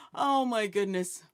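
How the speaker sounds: ordinary talk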